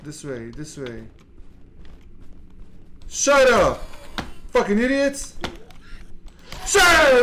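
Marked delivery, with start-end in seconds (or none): 3.1-7.2 loud, high angry shouts building to a scream; furious shouted insults; angry yelling. Real, not acted. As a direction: shout them down, hardest at the end. Shouting at noisy critters.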